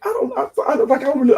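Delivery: high-pitched stammering protest; flustered reply. Retold or imitated. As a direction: act out a flustered, high-voiced stumble for an answer. Retold flustered answer under questioning.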